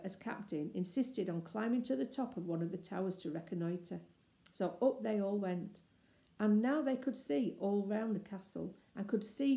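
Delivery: ordinary delivery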